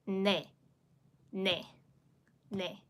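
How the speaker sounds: 네 with a nasal n; 把“ne”发成鼻音n开头的韩语네; Korean nasal ne. Shown as a demonstration. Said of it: "So I'll pronounce this word".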